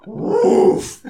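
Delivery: loud, high, breath-driven woof; admiring "woof". Retold or imitated. Storyteller reacting to the girl's body, loud woof. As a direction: bark out a big woof of admiration.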